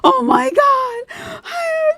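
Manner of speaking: very high, loud squeal of triumph; squealing like a dream came true; ecstatic shriek. Acted. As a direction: shout it out, drawn out and overjoyed. Overjoyed at being called a streamer.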